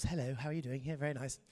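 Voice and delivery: a light hint of Paddington Bear; gently polite bear-like posh. Shown as a demonstration. A comic showing off his posh accent to the crowd, soft and prim. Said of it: "Paddington Bear levels".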